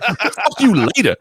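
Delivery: high imitated sign-off; put-on high voice of the woman boss; sassy lady boss. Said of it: "it was the same woman you talked to".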